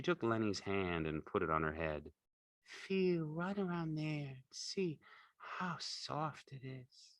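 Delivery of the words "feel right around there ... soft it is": slow, high, hushed woman's voice; soft invitation. A narrator's reading. Curly's wife invites Lenny to stroke, soft and slow. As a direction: coax softly, trailing away.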